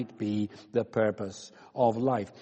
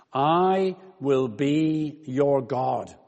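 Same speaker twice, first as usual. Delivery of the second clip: slow solemn quote; much slower than usual. Shown as a demonstration. Preacher savouring key phrase.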